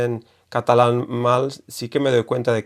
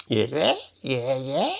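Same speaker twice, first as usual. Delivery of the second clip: laughing mock yeye; 3 semitones higher, 4 dB quieter than usual. Shown as a demonstration. Teacher laughing at a silly mispronunciation. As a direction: giggle through yeye, yeye. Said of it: "ei, yeyesh".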